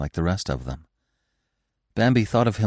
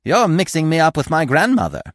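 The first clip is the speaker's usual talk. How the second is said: high, animated squirrel voice; good-humored squirrel; 9 semitones higher, 4 dB louder than usual. A narrator's reading. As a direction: correct him brightly and good-naturedly. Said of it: "looked at him good-humoredly".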